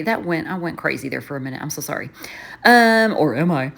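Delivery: ordinary talk, nothing marked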